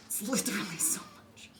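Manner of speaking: breathy whispered repeat; whispering. Acted. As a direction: whisper it under your breath.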